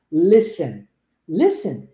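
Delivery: "Listen" as LIS-en, S straight into E; silent T. Shown as a demonstration. Teacher modelling for English learners, silent T in "listen". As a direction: repeat "listen" with no T at all. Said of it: "o T nunca vai ser pronunciado".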